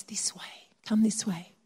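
soft unvoiced whisper, barely there; gentle whisper. God whispering the way back to the lost. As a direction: breathe it like a quiet call home.

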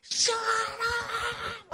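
high, sharp mock yell with a laugh; imitating the angry neighbour yelling, half laughing; retold neighbour's shout. Preacher retelling the neighbour yelling at barking dogs, mock yell. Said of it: "He's like".

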